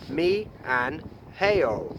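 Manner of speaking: "Mi an heo" said very slowly; slow Korean sorry. Shown as a demonstration. Vlogger teaching sorry for tripping someone, slow "Mi an heo". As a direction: stretch out "Mi an heo" gently. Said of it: "M at the beginning more sounds like an M B".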